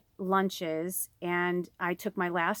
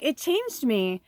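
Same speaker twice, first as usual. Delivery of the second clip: real tearful emotion; 7 semitones higher, 5 dB louder than usual. Real, not acted. Vlogger choking up while retelling her lonely Christmas, tearful. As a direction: let the tears come up as you say it. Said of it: "I'm starting to cry and get emotional".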